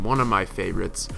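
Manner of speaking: normal talking voice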